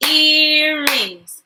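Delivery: "Earrings" stretched into two long parts; syllable split. Shown as a demonstration. Teacher breaking a word into parts, syllable split. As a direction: draw out each syllable of "Earrings". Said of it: "Break words into parts with me".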